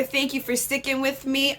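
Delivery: ordinary delivery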